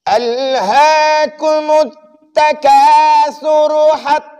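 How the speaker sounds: high, slow, drawn-out melodic chanting; practice recitation. Shown as a demonstration. Teacher leads a slow practice recitation, high melodic chant. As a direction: stretch each phrase out melodiously. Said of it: "मश्क पढ़ते हैं".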